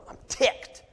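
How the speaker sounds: voiceless, tense mock anger; hammed-up seething; mock mad. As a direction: play at being ticked off, with no voice.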